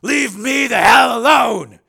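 high, drawn-out full-volume yell; furious shout. A narrator's reading. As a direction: bellow each word slowly at the helicopter. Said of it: "He shouted it at the man".

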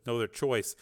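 ordinary delivery

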